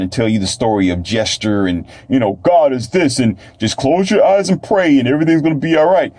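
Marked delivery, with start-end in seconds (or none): 2.4-6.1 mock preacher. Mimics a preacher's reassuring patter.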